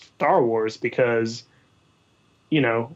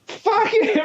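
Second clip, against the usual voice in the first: high mock voice. Mock outburst at J J Abrams.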